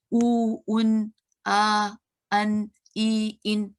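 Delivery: उ, उन, आ, अन, ई, इन each drawn out slowly, one by one; sounds demonstrated. Shown as a demonstration. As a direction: hold each sound उ, उन, आ, अन, ई, इन long and distinct. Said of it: "ये जो आवाज़ें हैं". (उ, उन, आ, अन, ई, इन को खींचकर अलग-अलग बोलें)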